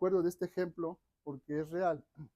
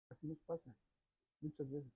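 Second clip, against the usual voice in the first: much softer repeat of "Así nos pasa"; quiet echo; 2 semitones lower, 12 dB quieter than usual. Shown as a demonstration. Preacher repeating the lesson to himself, quiet echo. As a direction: let "Así nos pasa" drop to a soft, inward murmur.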